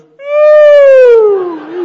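very high, loud imitated yelp of a shocked dog; dog yelp imitation. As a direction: let out a high squealing yelp like a zapped dog. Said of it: "And he goes".

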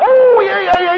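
very high, loud eager yelps; hammed-up sudden eagerness; eager mock excitement. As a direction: squeal it like a man remembering. Retold eager speaker quote.

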